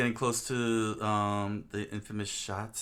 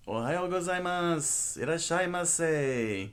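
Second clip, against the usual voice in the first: Japanese shop greeting; 8 semitones higher, 5 dB louder, slower than usual. Acted.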